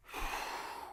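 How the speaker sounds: long blown-out exhale; heavy sigh. Retold or imitated. Trader reacting to others' bearish calls, heavy sigh. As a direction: let out a long, weary breath.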